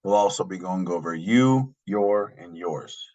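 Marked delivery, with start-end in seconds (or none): none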